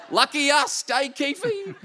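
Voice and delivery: dad talking to his pickled stonefish over steak, high mock voice; imitating dad. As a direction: ham up Dad chatting to his pet stonefish Keefy. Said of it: "He'd get the steak out and go".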